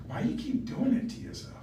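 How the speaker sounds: whispered question, breath only; hushed whisper to a worn-out mom. Retold or imitated. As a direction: ask it in a pleading whisper. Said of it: "And I'm just like".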